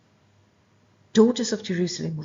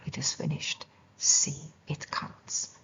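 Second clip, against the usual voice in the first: slow hushed whisper; 3 semitones lower, 5 dB quieter than usual. Retold or imitated. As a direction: whisper it, slowly and softly. Quoting the cry again.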